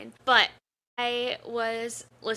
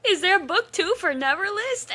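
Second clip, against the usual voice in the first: frantic question; 9 semitones higher, 4 dB louder than usual. Retold or imitated. Frantic googling retold.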